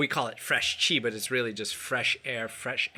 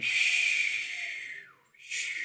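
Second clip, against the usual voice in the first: healing sound shh. Shown as a demonstration. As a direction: breathe out a slow shh from the liver. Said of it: "we make this sound".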